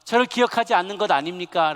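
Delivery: loud, high plea to God; lamenting appeal. Acted. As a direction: cry out a complaint in acted lament. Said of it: "라고 한탄하며 하소연하는".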